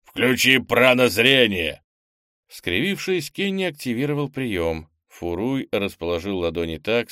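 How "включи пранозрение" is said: sudden loud slow bark; barked command. Acted. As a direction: bark the order at the boy. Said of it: "неожиданно гаркнул".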